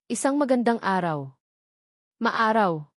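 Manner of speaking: ordinary delivery, nothing marked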